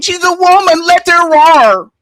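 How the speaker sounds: high, slow mocking voice for a meek man backing down; mocking cowering man. Host mocking men who cower before a woman, high and slow. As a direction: pitch it up and drag it out to mock a man backing down. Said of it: "cowering down and saying".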